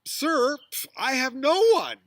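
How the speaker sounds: loud, high, offended protest; indignant defense. Acted. Sick man answering Jesus, loudly indignant. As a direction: snap back, insulted, with a scoff. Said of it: "The person might be like".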